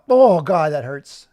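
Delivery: loud pained outburst; sudden loud groaned exclamation; mock pain. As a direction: cry out as if hit by an anvil. Groans as if the anvil hit.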